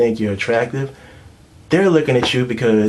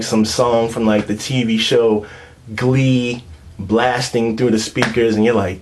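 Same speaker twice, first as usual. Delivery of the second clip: normal talking voice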